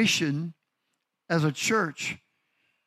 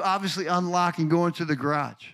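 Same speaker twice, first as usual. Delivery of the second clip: ordinary delivery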